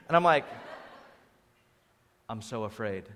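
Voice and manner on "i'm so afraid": low, very soft, fearful aside; hushed mock fear. Groom on camera before his wedding, hushed mock fear. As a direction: quietly confess the nerves, half in jest. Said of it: "this excitement and fear".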